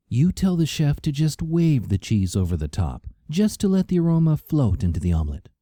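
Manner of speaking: a diva instructing the waiter; fussy diner. A narrator's reading. Karina orders from waiter.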